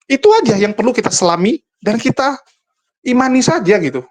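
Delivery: usual speaking voice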